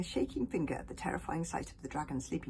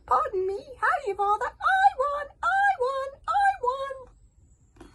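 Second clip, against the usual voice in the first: very high, loud crowing; hogfly shrieking after popping; 10 dB louder, slower than usual. Acted. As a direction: shriek it in triumph.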